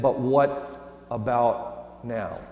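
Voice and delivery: "But" leaned on, slow and heavy; stress on but. Shown as a demonstration. Pastor showing Martha's doubt to listeners, "But" stressed slowly. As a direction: drag out "But" and let it hang. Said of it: "Do you hear the "but" at the end of it?".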